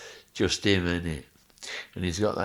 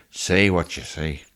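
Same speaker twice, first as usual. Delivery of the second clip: imitating Roy Walker; 8 dB louder than usual. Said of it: "in the other side going".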